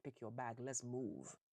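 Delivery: ordinary delivery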